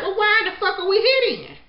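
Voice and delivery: high and loud, slowing on the swear; exasperated outburst. Retold or imitated. Host fed up with the catfish, loud exasperated outburst. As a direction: blurt it out, fed up and incredulous. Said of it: "So Max was like".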